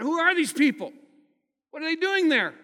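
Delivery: loud, high, fed-up disbelief; loud mock exasperation at politicians; exasperated outburst. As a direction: throw up your hands at the news. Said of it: "I fretted, I moaned, and complained".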